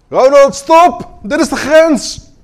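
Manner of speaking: high, slow, booming shout; shouted alarm, drawn out. Acted. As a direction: yell a stern warning at Ronald. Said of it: "staat niet te schreeuwen van".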